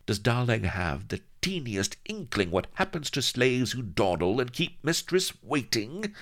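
high, sweetly threatening mistress; acid honey. A narrator's reading. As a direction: coo a threat with venom in it. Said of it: "acid honey boiled from the darkness".

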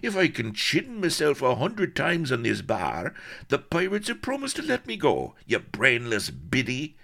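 high, strained, angry explanation; exasperated, insulting tirade; violent outburst. A narrator's reading. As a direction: rage from the gallows, pitched high. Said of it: "he added violently".

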